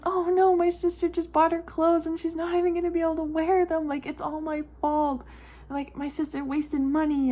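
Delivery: high, whiny self-mocking panic; panicked. Mom's brain racing over sister's gift, panicked. Acts out her panicked thoughts.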